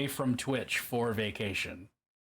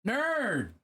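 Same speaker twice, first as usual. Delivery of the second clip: high, excited call of a friend's name; delighted high greeting call; 10 semitones higher than usual. Real, not acted.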